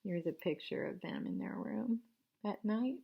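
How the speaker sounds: hushed bedtime whisper. Acted. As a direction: whisper so you don't wake them. Showing bedtime picture to children.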